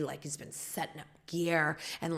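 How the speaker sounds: usual speaking voice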